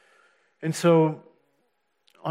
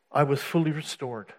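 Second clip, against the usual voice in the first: usual speaking voice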